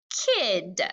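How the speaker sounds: put-on "kid" as one drawn-out syllable; blended word. Shown as a demonstration. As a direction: join the sounds smoothly into "kid". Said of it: "just like that".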